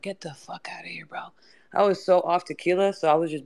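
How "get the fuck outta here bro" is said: whispered, voiceless brush-off; mock hushed dismissal. Guest retelling telling Jeremiah to leave, whispered dismissal. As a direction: drop to a whisper to wave them off. Retold dismissal, acted under breath.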